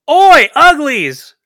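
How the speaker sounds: loud, high, drawn-out call; shouted taunt. Acted. Character shouting at orcs to get their attention, loud taunting yell. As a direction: holler it across at a distant enemy. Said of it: "Thayus shouts out".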